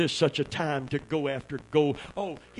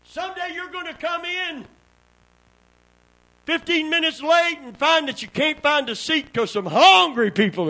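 high, loud shouted preaching; climbing sermon shout; preaching at full force; 13 semitones higher, 7 dB louder than usual. Acted. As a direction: whoop it up like a revival preacher.

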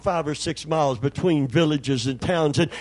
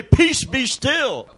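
commanding; 9 semitones higher, slower than usual. Acted. Commanding the storm, then inner storm.

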